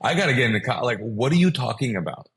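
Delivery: loud comic disbelief; freaking out. Retold or imitated. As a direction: act out a flustered protest. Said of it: "And I'm freaking out".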